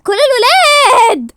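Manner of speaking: long, high, loud shout; full-voice stretched call. Acted. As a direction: summon them with a drawn-out yell. Calling all the boys.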